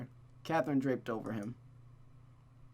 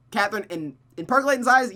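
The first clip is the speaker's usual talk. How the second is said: mock character voice; 8 semitones higher, 10 dB louder than usual. Mocking voice of a character.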